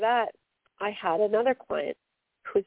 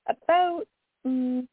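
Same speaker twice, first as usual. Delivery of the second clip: Canadian vowel in "about", pitch jumping up on it; Canadian "about", said high and unguarded; Canadian accent; 5 semitones higher, much slower than usual. Real, not acted. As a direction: let your own Canadian "about" slip out. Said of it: "hear my Canadian accent there".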